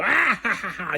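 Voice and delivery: high-pitched, hammed-up evil cackle; mock cartoon villain's cackle, starting high; Jafar villain laugh. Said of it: "the original Jonathan Freeman is, is Jafar".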